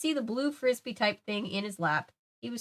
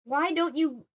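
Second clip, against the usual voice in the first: angry; 6 semitones higher than usual. Acted. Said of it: "I let out an angry scream".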